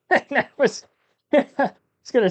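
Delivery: high giggly voice breaking; talking through giggles; laughing. Real, not acted. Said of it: "couldn't even get it out without laughing".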